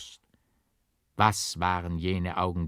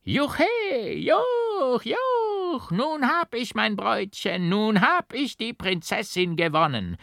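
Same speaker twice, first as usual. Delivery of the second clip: shrill, much higher, louder crowing; triumphant mad shriek; 14 semitones higher, 3 dB louder, slower than usual. A narrator's reading. The madman after stabbing the bride, shrieking in triumph. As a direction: whoop like a lunatic who has won. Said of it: "so schrie der Rasende auf".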